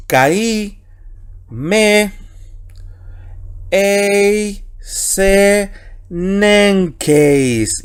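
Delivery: word by word, each drawn out with long pauses; Greek reading demonstration. Shown as a demonstration. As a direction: read the Greek out slowly and emphatically for students to follow. Reads Greek text word by word.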